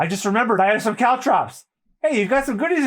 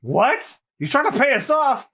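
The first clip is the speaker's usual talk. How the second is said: ordinary talk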